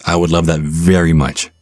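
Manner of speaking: ordinary talk, nothing marked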